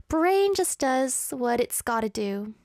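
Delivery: usual speaking voice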